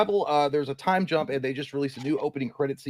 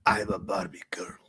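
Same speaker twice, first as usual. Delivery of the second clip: low whisper, barely voiced; whispered song line; 6 semitones lower, 5 dB quieter than usual. Host repeats the lyric after scolding Eric, whispering.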